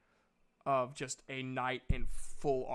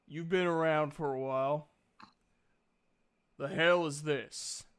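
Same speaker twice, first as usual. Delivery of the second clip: booming god hello; 5 dB louder than usual. Acted. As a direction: boom a wary hello. God voice questioning.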